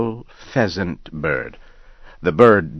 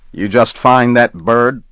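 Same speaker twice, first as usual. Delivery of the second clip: raised, louder challenging question; suspicious hunter; 6 semitones higher, 6 dB louder than usual. Acted. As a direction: demand an answer like a rough hunter.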